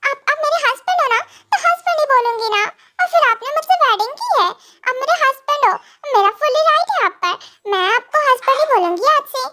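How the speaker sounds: high-pitched, possessive insistence; insistent wife. Acted. Wife claiming her right to call him husband, insistent.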